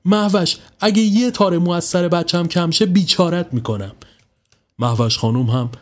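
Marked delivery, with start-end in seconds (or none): 0.0-3.9 higher, menacing threat; a mother lashing out at a neighbour; threatening. Acted. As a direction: warn her fiercely. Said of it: "با حالتی تهاجمی گفت".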